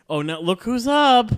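high, loud mocking jab; sarcastic retort. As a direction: throw it back at him with scorn. Mocking the drunk date back.

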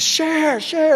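put-on high childish whine of share; imitating a little girl demanding; daughter demanding. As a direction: kid sister's demand, high. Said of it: "And she's".

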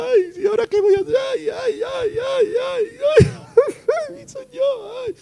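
hammed-up high-pitched wailing; mock crying. Preacher imitating a believer crying to the Lord, mock wailing. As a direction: wail "ay" in an exaggerated fake sob. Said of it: "Cuando tú estás ahí llorando".

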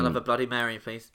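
nasal passenger voice. Retold or imitated. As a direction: order it through a nasal voice. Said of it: "that kind of nasal voice".

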